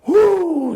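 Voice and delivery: high shrieking wrestler whoo; Flair whoo. Retold or imitated. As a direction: let out a wild whoo.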